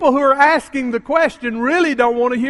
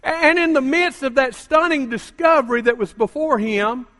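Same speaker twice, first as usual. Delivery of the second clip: ordinary talk, nothing marked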